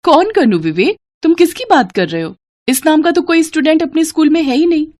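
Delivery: bewildered madam; puzzled teacher. A narrator's reading. Teacher surprised on stage.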